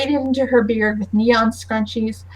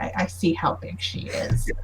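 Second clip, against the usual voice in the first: dry muttered aside; 7 semitones lower, 9 dB quieter than usual. Acted. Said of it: "And Cindy's like".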